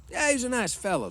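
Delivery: high, loud, ready agreement; agreeing swamper. A narrator's reading. Old swamper vouching for the boss, agreeing. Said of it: "the swamper agreed".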